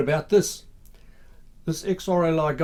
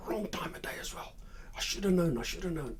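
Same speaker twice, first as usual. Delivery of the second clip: hushed muttering to oneself; muttered self-rebuke; 3 semitones higher, 8 dB quieter, faster than usual. Acted. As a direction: mutter it under your breath, half whispered. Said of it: "muttering a few curses".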